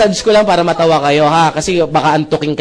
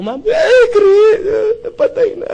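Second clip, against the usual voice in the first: wailing widow; slower than usual. Retold or imitated. Widow wailing at husband's death.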